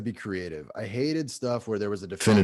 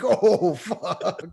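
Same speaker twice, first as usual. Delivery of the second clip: laughing; 11 semitones higher, 6 dB louder than usual. Retold or imitated. His own reaction, laughing.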